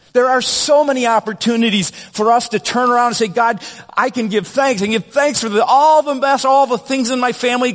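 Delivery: impassioned preaching. Acted. Pastor modelling a thankful prayer for the congregation, fervent preaching.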